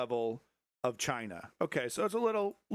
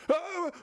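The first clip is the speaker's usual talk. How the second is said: squealed shout; 3 dB louder than usual. Pretend. Mock chat shout.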